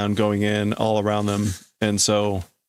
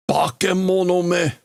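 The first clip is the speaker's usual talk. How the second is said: higher-pitched insult with contempt; contemptuous; 6 semitones higher than usual. Acted. Reader voicing a character cursing a monster, contemptuous. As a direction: spit it at the monster. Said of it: "Me just adds even more contempt".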